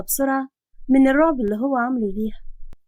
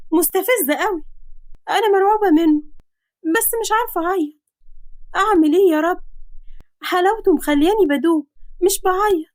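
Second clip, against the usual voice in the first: put-on higher, flustered girl's voice; young heroine voice; 6 semitones higher than usual. Girl alone in his house, frightened and flustered. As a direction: play the scared, smitten girl.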